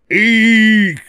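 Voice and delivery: high, loud squeal of fright; shriek. A narrator's reading. Monsters fleeing Lucy, high loud shriek. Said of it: "they shrieked".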